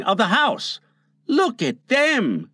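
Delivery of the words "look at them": high, drawn-out exclamation; crying out. A narrator's reading. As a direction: cry out at the sight, without extra volume. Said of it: "he cried".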